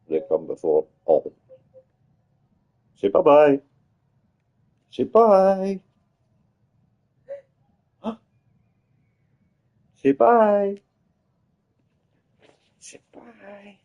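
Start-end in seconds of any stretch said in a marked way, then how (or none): 2.9-10.8 high sing-song coaxing voice. Real, not acted. Dad coaxes his daughter to wave bye to viewers, high baby talk. 12.8-13.8 high and very soft coaxing. Real, not acted. Dad quietly coaxes his daughter one last time, high and hushed.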